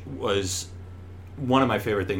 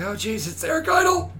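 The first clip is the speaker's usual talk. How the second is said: squeaky high starstruck gasp of a line. Retold or imitated. Actor meeting his comic idol, starstruck high squeak. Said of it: "I was like".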